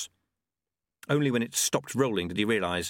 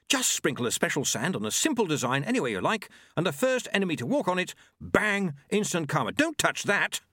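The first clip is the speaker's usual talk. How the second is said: keen inventor monk, pitched up; brisk higher demo of a gadget; 8 semitones higher than usual. Acted. Monk explaining his exploding sand, enthusiastic.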